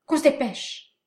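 loud call from behind cover; distant shout. Acted. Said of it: "La voix de Carbon derrière le talus".

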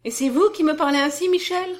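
high, loud, incredulous question; protest, rising high on the final name; shocked reproach. A narrator's reading. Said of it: "se récria Edmé". (reproche incrédule, voix haute et forte)

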